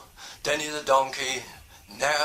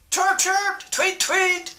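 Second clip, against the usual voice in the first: high, louder chirp and tweet; bird chirps; 4 dB louder, slower than usual. Acted. Billy Blackbird singing along with bright high chirps. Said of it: "said Billy Blackbird".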